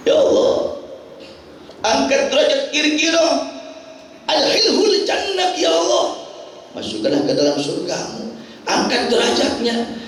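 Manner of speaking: high-pitched imploring prayer, imitating mourners; acted grieving plea; tearful prayer. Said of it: "Sahabat pada nangis".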